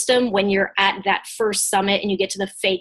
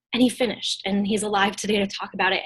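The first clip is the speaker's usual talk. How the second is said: ordinary delivery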